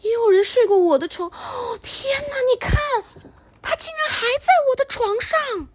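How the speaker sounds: very high, gasping, whimpering cub; baby bear voice. Acted. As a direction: squeak in alarm like a cub. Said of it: "宝宝熊就说".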